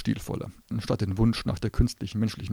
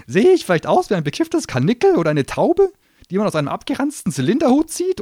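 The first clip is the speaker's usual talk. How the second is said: high, loud sneering gnome voice; a cornered little demon spitting scorn; shrill gnome tirade; 11 semitones higher, 10 dB louder than usual. Acted. Gnome ranting at Susi.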